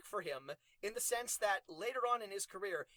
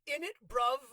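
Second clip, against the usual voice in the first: mock Cockney; 7 semitones higher, 8 dB louder than usual. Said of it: "It's like".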